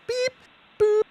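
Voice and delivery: high beep boop; imitated beep tone. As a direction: mimic a radio beep, high and short. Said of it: "that tone that we're hearing".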